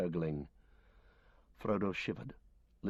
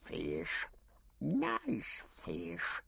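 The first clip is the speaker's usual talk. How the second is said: Gollum voice; 2 semitones lower, much slower than usual. Acted. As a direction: croon and snarl like a starved cave creature gloating over fish. Gollum answers from the dark.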